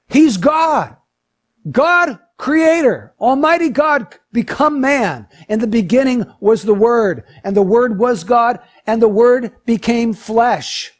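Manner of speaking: loud, high, ringing proclamation; full-force preaching. Real, not acted. Preacher declaring to the congregation that Jesus is God, full-force preaching. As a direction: proclaim it with drawn-out, weighty words. Preaching climax on deity of Christ.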